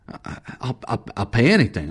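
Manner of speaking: hushed, halting false starts then a blurted plea; cowed, faltering bargaining; frightened stammer. Acted. Eccles stammers a desperate offer.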